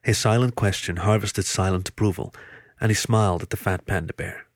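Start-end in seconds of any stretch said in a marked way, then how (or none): none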